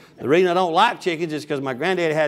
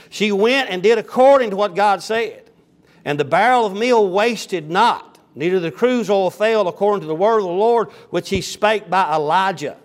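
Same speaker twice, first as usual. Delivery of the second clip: raised pulpit reading of the King James text; scripture reading; 3 semitones higher, 3 dB louder than usual. Acted. Preacher reading the Bible aloud to his congregation, raised and measured. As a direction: read the Word out to the congregation.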